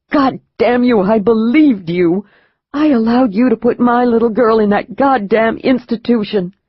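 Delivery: a betrayed mother lets it all out; tearful anger growing at those who lied; rising fury. Acted. Mother accuses them all, furious.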